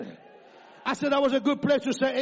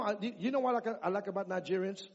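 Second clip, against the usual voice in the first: ordinary talk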